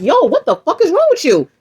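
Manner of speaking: slow, high, drawn-out yell; retold angry yell. Yelling at harasser again.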